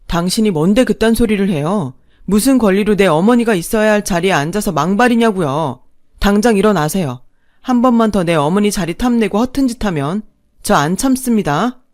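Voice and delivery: loud, forceful angry warning; furious son. Acted. Son ordering second mother out of his mother's seat, furious. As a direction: confront her and defend your mother.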